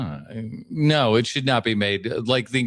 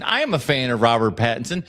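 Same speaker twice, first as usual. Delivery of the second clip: ordinary talk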